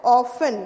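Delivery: Often said loudly with silent t; teacher modelling. Shown as a demonstration. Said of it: "क्या होता है". (ज़ोर से साफ़ Often)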